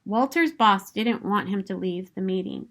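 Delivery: usual speaking voice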